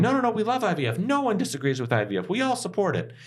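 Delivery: high singsong mock of Republicans. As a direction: sneer it up high, all innocent. Mocking Republicans' IVF claims.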